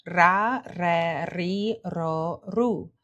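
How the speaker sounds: "ra, re, ri, ro, ru" with trilled R; "ra, re, ri, ro, ru" as a connected trill exercise; rolled R drill. Shown as a demonstration. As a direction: roll the R before each vowel. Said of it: "Now try".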